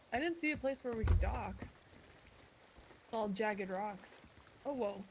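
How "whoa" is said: ordinary talk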